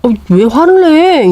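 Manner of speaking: wife drawing out a startled question; taken aback. Retold or imitated. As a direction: pull back, surprised he snapped. Wife answers angry husband.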